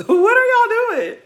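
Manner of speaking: incredulous laughing shriek; laughing disbelief. Real, not acted. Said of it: "repeated with laugh".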